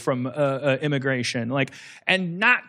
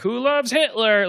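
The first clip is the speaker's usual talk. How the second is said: mock sandwich-board cry; 7 semitones higher, 4 dB louder than usual. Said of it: "board that goes like".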